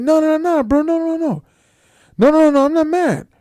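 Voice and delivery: high, loud acted-out denial; hasty reassurance. Rapper assuring coworker he isn't mad, loud protest. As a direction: repeat the no's in a high, protesting voice.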